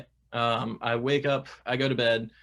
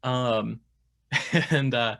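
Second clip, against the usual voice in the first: ordinary delivery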